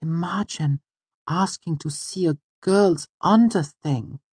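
high, girlish mock reproach; high-pitched coy girl; self-conscious Auri. A narrator's reading. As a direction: act bashfully scandalised in a high voice. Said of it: "tugging self-consciously".

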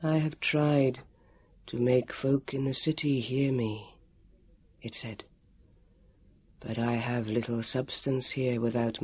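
low, slow, thin ghost voice; thin and far away, fading to a whisper; unearthly voice. A narrator's reading. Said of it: "its voice was spread as thin".

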